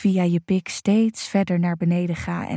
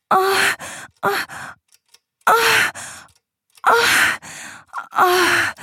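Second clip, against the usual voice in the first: orgasm cries; 10 semitones higher, much slower than usual. Acted. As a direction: let go fully at the peak. Orgasm climax.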